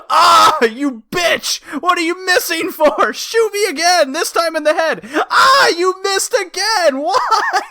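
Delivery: high, hammed-up agonized yelling; Raiden. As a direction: wail and rant like a wounded cartoon hero.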